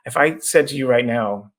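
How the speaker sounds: ordinary talk, nothing marked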